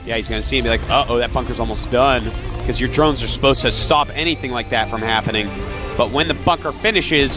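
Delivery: ordinary talk, nothing marked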